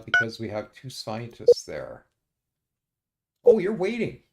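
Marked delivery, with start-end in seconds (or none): 3.4-4.2 sudden realization. Real, not acted.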